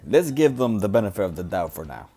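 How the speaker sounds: normal talking voice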